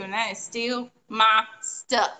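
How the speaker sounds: "my stuff" slowly, each word set apart; mock-stern, weighty "my" and "stuff"; emphatic slow finish. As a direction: lay down the law, slowing on "steal my stuff". Laying down the rule, playfully.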